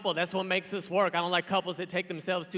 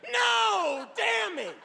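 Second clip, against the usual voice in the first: much higher, strained fake death cry; mock dying cry; 14 semitones higher, much slower than usual. Comic pretends to be shot, hammed-up high cry. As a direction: ham up getting shot in a high wail. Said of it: "He was like, I said".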